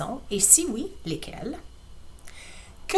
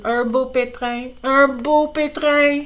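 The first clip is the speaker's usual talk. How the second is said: Laurie's lament; 4 semitones higher, slower than usual. Acted. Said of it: "dit Laurie".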